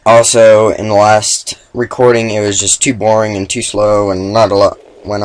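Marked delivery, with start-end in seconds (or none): none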